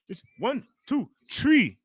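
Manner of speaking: put-on Irish accent. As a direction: mimic the Irish commentator counting, "tree" for three. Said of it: "He has the worst accent".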